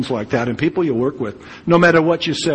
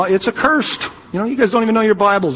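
mocking Pharisee scorn; 8 semitones higher, faster than usual. Preacher mocks the Pharisees' scorn.

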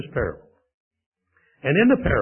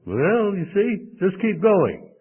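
ordinary talk, nothing marked

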